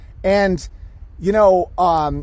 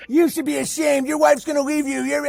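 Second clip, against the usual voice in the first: high-pitched imitation of scolding critics; mock scolding; 8 semitones higher than usual. Imitating people who shame the speaker, mock scolding. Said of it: "They're like".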